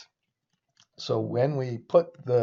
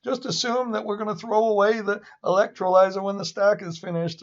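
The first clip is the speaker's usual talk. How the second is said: high, whiny mock voice; mocking Bloomberg analysts; 8 semitones higher than usual. Lecturer mocking Bloomberg analysts' dismissive advice, high whiny mockery. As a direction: parody the know-it-all analysts. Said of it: "people at Bloomberg who say".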